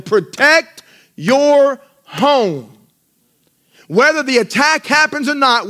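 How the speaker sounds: high, loud, slow charge; preaching at full force. Acted. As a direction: call the church to fight for home.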